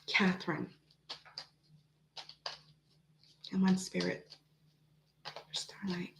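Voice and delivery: very soft, low murmur; hushed invocation. Real, not acted. Reader calling on Spirit for Starlight, hushed.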